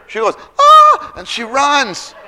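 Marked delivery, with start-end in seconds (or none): 0.6-1.1 very high, loud shriek of shock; startled scream. Acted. Servant girl finding Peter at the door, startled shriek. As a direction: let out a sudden acted scream.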